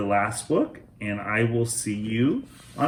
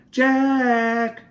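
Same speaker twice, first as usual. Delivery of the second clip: dad calling, high and loud; dad calling the kids in from the porch; 6 dB louder, much slower than usual. Acted. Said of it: "he called".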